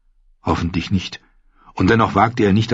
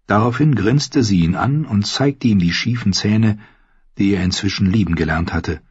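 usual speaking voice

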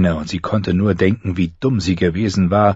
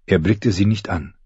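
usual speaking voice

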